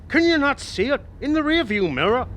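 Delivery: high, loud urgent alarm; urgent excited. Acted. Old man urges the cabbie, high and alarmed. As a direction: point it out in a rush of alarm. Urging driver to lose cop.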